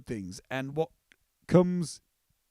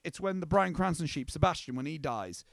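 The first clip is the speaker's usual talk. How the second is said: ordinary talk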